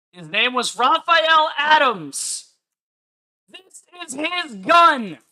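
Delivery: slow, loud, high-pitched proclamation; triumphant reveal. Real, not acted. Streamer reveals the gun to his chat with a slow triumphant shout. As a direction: shout it out like a gotcha moment.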